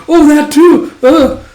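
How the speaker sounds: very high, awkward stammer; high flustered voice. Retold or imitated. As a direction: squeak out the admission.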